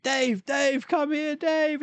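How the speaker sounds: high, strained call for a friend; a high voice shouting from up a tree; mock injured man calling out; calling for help. Retold advert, injured man calling.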